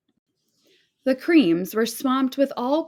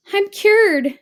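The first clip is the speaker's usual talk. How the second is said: much higher, louder cheer; joyful shout; 12 semitones higher, 9 dB louder than usual. A narrator's reading. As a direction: shout for joy. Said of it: "she shouted".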